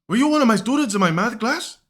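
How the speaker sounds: put-on a squeaky, incredulous old teacher; imitating Carlos Esposito. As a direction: math teacher questioning his former student, high and loud put-on voice. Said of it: "he sounds just like, uh, Carlos, uh, Esposito".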